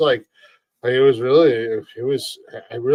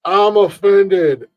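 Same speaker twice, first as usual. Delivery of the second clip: drawn-out, high, loud mock outrage; mock offended; 6 semitones higher, 6 dB louder, much slower than usual. Co-host mocking people who take offense at anything, mock indignant. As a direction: play up being offended, stretching it slowly.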